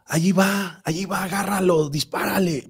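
high-pitched retold night-time yelling, no real volume; mock shouting. Storyteller voicing a husband's night terrors, mock shouting. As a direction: imitate a man yelling out in his sleep. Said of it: "y empezaba a gritar".

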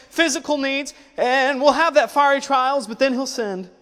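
loud, high, driving sermon delivery; fiery preaching. Acted. Preacher rousing the congregation about life's trials, loud and fervent. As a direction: preach it out to the whole church, full force. Preacher builds to full force.

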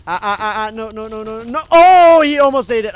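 high, loud alarmed cry; panicked shout. Real, not acted. Gamer panicking at a zombie near his plant, loud high yelp. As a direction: yelp as the plant nearly goes.

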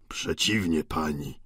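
low, subdued reply; meek old Goriot. A narrator's reading. Old Goriot answers landlady.

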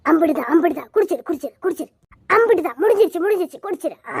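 usual speaking voice

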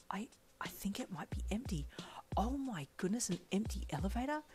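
hesitant whisper building to a giddy question; excited hushed thought. A narrator's reading. Shy girl thinks the elevator is empty, hushed excitement.

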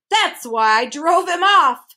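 high, slow, loud boast; blustering, drawn-out cry. A narrator's reading. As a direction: cry it out fiercely. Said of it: "cried the humbug".

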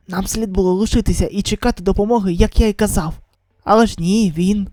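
usual speaking voice

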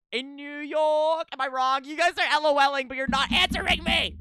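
high, worked-up complaint; high, loud protest; exasperated pleading. Real, not acted. Pleading with chat for answer.

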